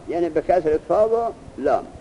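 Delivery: ordinary talk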